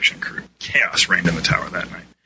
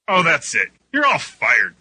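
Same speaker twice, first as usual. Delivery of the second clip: mildly raised, higher angry outburst; fed-up shout; 6 semitones higher, 4 dB louder than usual. A narrator's reading. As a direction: fire everyone in mock fury. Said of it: "Dan shouted".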